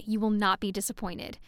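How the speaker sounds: ordinary talk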